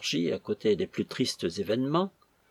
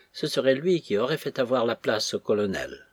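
ordinary delivery, nothing marked

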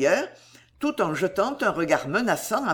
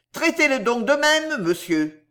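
high, insistent challenge; high-pitched defiance, dropping on the last word; raised tone; 7 semitones higher than usual. A narrator's reading. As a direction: repeat the dare at a raised pitch. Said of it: "répéta Slurk d'un ton élevé".

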